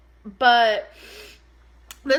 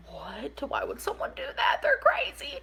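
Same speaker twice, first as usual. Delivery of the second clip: high, breathless whisper of disbelief; shocked whisper; 4 dB quieter than usual. Retold or imitated. Retelling her reaction to the catfishing, in a shocked whisper. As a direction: hush it like it's scandalous.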